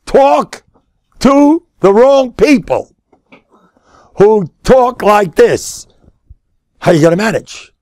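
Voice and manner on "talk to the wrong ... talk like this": high, slow, emphatic warning; forceful preaching. Real, not acted. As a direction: drive each word home, slowly.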